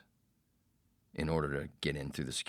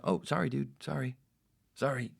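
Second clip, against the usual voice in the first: higher-pitched, falling repeated sorries; hasty apology; 9 semitones higher than usual. Retold or imitated. Producer apologizes to the ranting host, placating. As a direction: placate an angry man, mildly exasperated.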